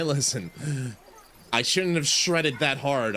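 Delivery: ordinary delivery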